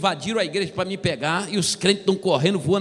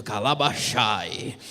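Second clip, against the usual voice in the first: lower, slow, strange tongue-speaking voice; mock tongues, low and slow; 9 semitones lower, much slower than usual. Another believer speaking in tongues, low slow mock. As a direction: drone out fake tongues deep and slow. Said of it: "E o outro".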